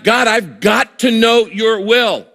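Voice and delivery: high, slow, urgent plea; passionate prayer. Acted. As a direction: plead with God, drawing the words out. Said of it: "intensity or passion in your prayer".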